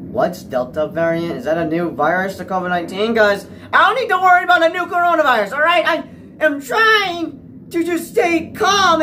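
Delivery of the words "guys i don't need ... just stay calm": high, loud and rushed, on edge; anxious outburst. Real, not acted. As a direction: blurt it out in a panic. Panicked rant about new virus.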